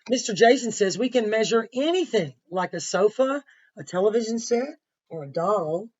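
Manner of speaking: lively picture-book narration; reading to children. A narrator's reading. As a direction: read it to the class with energy. Reads story aloud to class.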